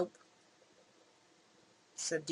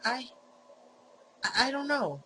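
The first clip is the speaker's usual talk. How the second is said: high, loud panicked denial; caught off guard; 6 semitones higher, 5 dB louder than usual. Acted. Fake Mike caught out by Sarah, panicked high denial. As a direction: blurt it out, cornered and flustered.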